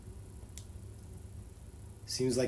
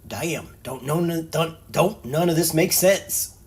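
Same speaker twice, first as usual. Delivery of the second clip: Barret voice; 5 semitones higher, 8 dB louder, slower than usual. Acted. Voicing Barret confused.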